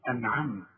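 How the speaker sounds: أنعمت ending on bare t; stopped final consonant. Shown as a demonstration. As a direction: stop أنعمت with no final vowel. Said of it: "وإذا وقفت بسكن الحرف". (أنعمت بتاء ساكنة)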